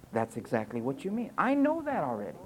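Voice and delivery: normal talking voice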